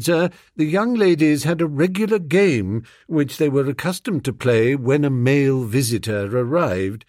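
ordinary delivery

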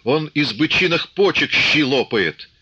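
high, drawn-out angry calls; indignant outcries. A narrator's reading. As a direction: take up the angry cry from the crowd. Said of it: "подхватили ближние".

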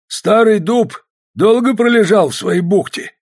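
loud, high, drawn-out greeting; boisterous hailing. A narrator's reading. Fedoseich teases the man long laid up, boisterous shout. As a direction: holler it slowly at an old friend.

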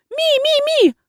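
high, loud creature squeal. Acted. Offended familiar squeals at the rector, high loud squeal. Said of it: "и заверещал".

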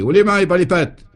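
high, loud brigadier's holler; yelled order. A narrator's reading. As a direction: yell at the suspects to surrender. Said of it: "hurla le brigadier". (ordre hurlé par le brigadier)

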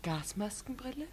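unvoiced, questioning whisper; astonished whispered question. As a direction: breathe the word back in disbelief. Incredulous echo of the word.

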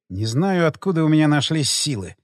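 usual speaking voice

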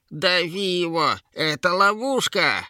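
high, frantic creature shout; Yera yelling. A narrator's reading. Troll-woman warns trolls of a trap, high frantic yell. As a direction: scream a warning in a troll-woman voice. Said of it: "заорала она".

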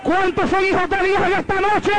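high shouted question to the crowd; preacher at the peak of his call. Acted. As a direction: shout it to the whole church. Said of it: "repeated call".

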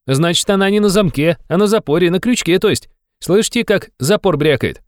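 high, insistent youthful reasoning; excited young man. A narrator's reading. Young man excitedly explains his discovery. (взволнованно, высоким молодым голосом)

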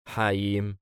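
usual speaking voice

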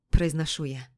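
plain reading voice

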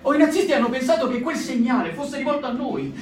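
usual speaking voice

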